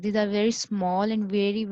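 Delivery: ordinary talk, nothing marked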